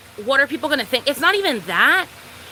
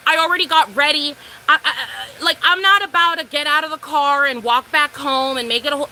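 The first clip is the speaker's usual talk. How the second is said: ordinary talk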